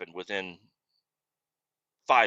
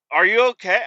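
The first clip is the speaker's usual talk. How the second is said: higher, louder worried question; alarmed coworker; 9 semitones higher, 7 dB louder than usual. Retold or imitated. Mechanic hearing about the rollover, alarmed and higher. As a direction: sound suddenly concerned.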